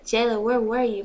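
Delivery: loud scolding dad; mild yell, demanding an answer. A narrator's reading. Dad yells at daughter who came home, scolding. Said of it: "my dad yelled at me".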